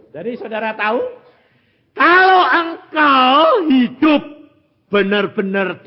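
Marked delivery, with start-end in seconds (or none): 1.9-5.8 high, loud and slow; forceful preaching. Real, not acted. Preacher urging the congregation, loud, high and slow. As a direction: drive it home to the congregation.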